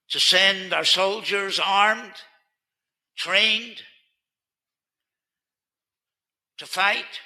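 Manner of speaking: loud, slow, driving sermon delivery; rally them behind the soldiers; rallying preacher's call. Acted.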